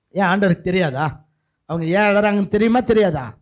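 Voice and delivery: ordinary talk, nothing marked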